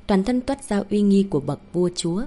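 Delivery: plain reading voice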